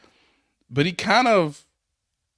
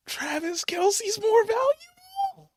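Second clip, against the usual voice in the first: much higher, slow, almost crying; slow mock-anguished squeal; high drawn-out punchline; 3 dB quieter, slower than usual. Real, not acted. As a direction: stretch it out high like a wail. Punchline dragged out.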